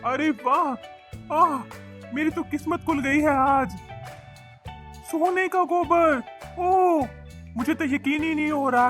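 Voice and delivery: can't believe your luck, voice lifted; overjoyed amazement. Acted. Fisherman finding golden dung, overjoyed and amazed. Finds dung turned to gold.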